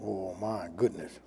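very low, soft aside to himself; hushed awe. Real, not acted. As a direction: murmur it low, overwhelmed by the word. Preacher marvels to himself.

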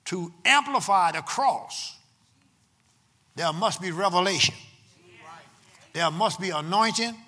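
loud, emphatic preacher with long-held key words; full-force preaching. Real, not acted. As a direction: preach it to the congregation in bursts, punching the key words.

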